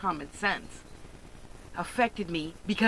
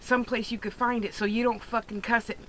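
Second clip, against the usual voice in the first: ordinary talk, nothing marked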